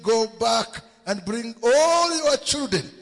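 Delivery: high, loud preacher's proclamation; impassioned preaching. Acted. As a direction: call it out to the whole congregation.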